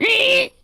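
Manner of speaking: piercing high screech; movie screech. Retold or imitated. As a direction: shriek it like a movie sound effect. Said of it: "like that screech".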